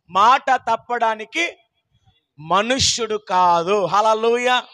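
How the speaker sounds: normal talking voice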